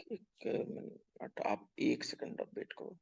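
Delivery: barely voiced, very soft; hushed aside. Real, not acted. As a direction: drop to a near-whisper while asking them to wait. Quiet aside asking students to wait. (बहुत धीमी, दबी आवाज़ में)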